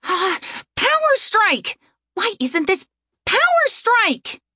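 high, slow shouted attack call; shouting. A narrator's reading. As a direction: yell the move name, high and drawn out. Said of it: "shouting all the while".

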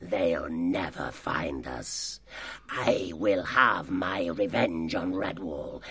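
grandiose villain speech to his gang; sly, self-glorying fox chief; sly boasting. Acted. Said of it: "egged them on with his sly tongue".

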